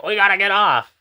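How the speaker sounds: imitating a panicked, high, loud yell; mock panic, shouted high; freaking out. Plane passenger warning everyone to get off, freaking out. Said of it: "the guy freaks out".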